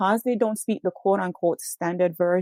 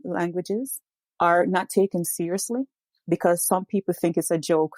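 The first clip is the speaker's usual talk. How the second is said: ordinary talk, nothing marked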